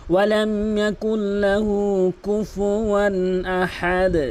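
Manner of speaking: slow chanted recitation; Quran recitation. Shown as a demonstration. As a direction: recite it slowly, tajweed style. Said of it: "আহাদও বলবেন না".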